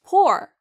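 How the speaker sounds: high, loud poor as "POR"; emphatic teaching model of poor; strong stressed syllable. Shown as a demonstration. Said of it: "좀 더 강하고 길게 발음하세요".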